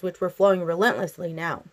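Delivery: ordinary delivery, nothing marked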